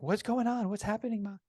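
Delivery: high, mildly panicked imitation of a little kid; freaking out child. Young daughter asks her mother what's happening, freaking out. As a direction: mimic a worried child in a high voice. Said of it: "Veronica's freaking out".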